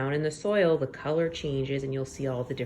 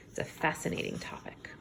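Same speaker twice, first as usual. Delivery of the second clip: soft breathy whisper to the class; whispered aside; 10 dB quieter, faster than usual. Real, not acted. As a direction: lean in and whisper it.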